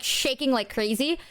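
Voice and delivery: normal talking voice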